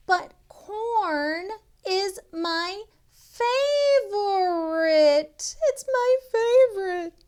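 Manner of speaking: high, loud mock lament; mock wail. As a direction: whine about the lost corn. Sheep's distress at corn.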